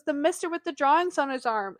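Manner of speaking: lightly voiced daughter explaining; child's voice. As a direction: pipe up like a small child. Voices young daughter answering her mother.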